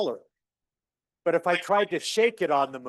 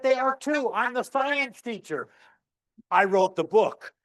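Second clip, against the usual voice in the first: higher, mock-indignant teacher insisting; 6 semitones higher than usual. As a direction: puff up like an offended teacher.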